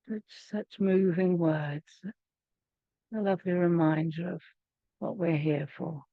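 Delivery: unhurried, hushed meditation guide; guided meditation. Acted. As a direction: ease them gently back out of stillness. Brings group out of prayer.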